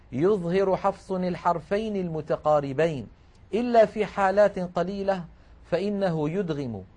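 ordinary delivery

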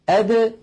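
أد with the د released in a bounce; qalqala d. Shown as a demonstration. Teacher shows the correct bouncing د. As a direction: pop the د of أد open. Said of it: "هكذا".

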